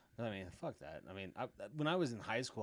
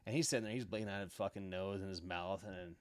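normal talking voice

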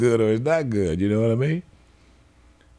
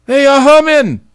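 much higher and louder, stretched out; emphatic shout; 12 dB louder than usual. Real, not acted. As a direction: belt it out slowly. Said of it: "emphatic exclamation".

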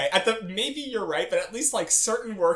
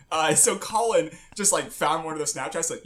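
ordinary delivery

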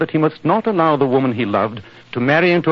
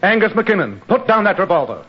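higher, urgent command; 6 semitones higher than usual. Acted. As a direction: order him to drop the gun. Stopping a man with gun.

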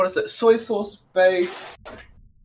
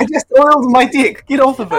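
mock indignant; 4 semitones higher, 5 dB louder than usual. Indignant bro voice.

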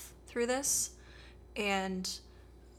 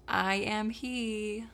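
slow dramatic reveal; much slower than usual. Retold or imitated. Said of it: "he's like".

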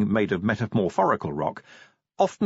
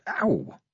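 short, high "ow" of pain; acted high cry of pain; pained yelp; 12 semitones higher than usual. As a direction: yelp at a sudden cut. Cuts hand on a nail.

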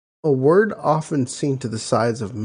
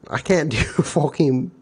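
normal talking voice

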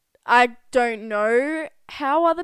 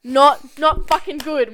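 loud, higher-pitched emphatic swearing; angry outburst; 4 semitones higher, 8 dB louder, slower than usual. Real, not acted. Podcast host on mixing drinks and hangovers, angry outburst. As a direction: burst out loudly in real exasperation. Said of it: "She got fucking angry".

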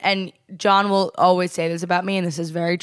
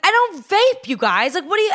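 defensive; 11 semitones higher, 3 dB louder than usual. Retold or imitated. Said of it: "got really defensive".

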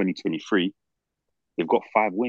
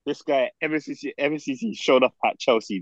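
ordinary talk, nothing marked

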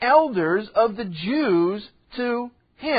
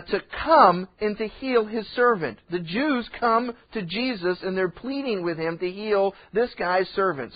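ordinary talk, nothing marked

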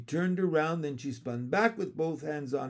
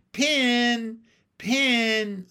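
high, loud calls of a name; calling out; 9 semitones higher, 9 dB louder than usual. A narrator's reading. Frog calling loudly for missing Pin. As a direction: call out for someone missing. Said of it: "I called".

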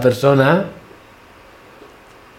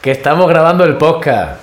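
raised, higher phone-call voice; 6 semitones higher than usual. Real, not acted. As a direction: project to someone on speakerphone. Repeats for brother who missed it.

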